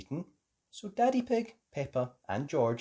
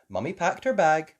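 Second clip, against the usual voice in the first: ordinary delivery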